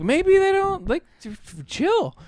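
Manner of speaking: very high, hushed plea to calm down; high-pitched tease telling the characters to chill; mock squeaky aside. Podcaster mocking the movie couple in a very high voice. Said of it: "And then I'm like".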